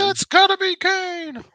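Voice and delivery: high, drawn-out mock commentary shout; excited wrestling commentator. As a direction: cry it out like a ringside announcer calling a surprise entrance. Mimics commentator's famous wrestling call.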